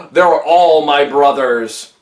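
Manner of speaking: mock heartfelt. Monkey's fake emotional claim.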